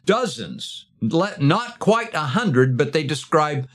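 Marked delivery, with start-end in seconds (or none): none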